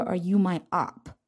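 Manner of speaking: usual speaking voice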